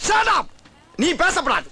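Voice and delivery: head constable shouting down his junior, angry. Acted. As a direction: cut him off hard.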